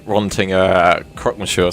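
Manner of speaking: ordinary delivery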